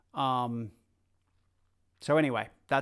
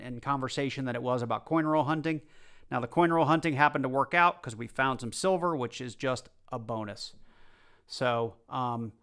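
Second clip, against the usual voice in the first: normal talking voice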